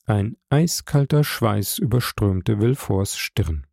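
ordinary delivery, nothing marked

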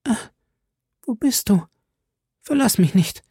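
high, weak plea; plaintive high dying voice; desperate pleading. A narrator's reading. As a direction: beg him to stay, voice thin with dying. Dying woman pleads with lover.

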